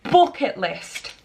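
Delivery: ordinary talk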